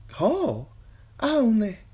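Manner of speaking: high, faltering singer caught out; nervous singer cut off; flustered singer. A narrator's reading. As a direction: stammer in fright. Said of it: "turned to lead".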